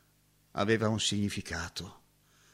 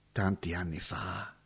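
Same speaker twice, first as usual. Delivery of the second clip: soft, low, far-away murmur; fading memory; 6 semitones lower, 8 dB quieter than usual. Acted. Roberto musing on the past, dropping to a hush. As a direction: let it die away into the past.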